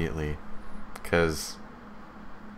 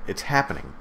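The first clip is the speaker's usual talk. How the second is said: usual speaking voice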